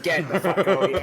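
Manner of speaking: high, loud, laughing; laughing disbelief. Retold or imitated. Retelling free food on the train, high laughing disbelief.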